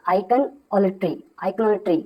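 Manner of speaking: iconolatry, very slow and clear; teacher modelling iconolatry; careful pronunciation. Shown as a demonstration. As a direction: sound out iconolatry for the class. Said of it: "इसको ध्यान से पढ़िएगा".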